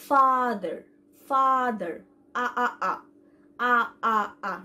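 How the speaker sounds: father with a long open 'aa', then আ repeated separately; model pronunciation. Shown as a demonstration. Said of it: "এ ওয়ার্ডটি উচ্চারণ করে". (Father-এর খোলা আ আলাদা করে উচ্চারণ)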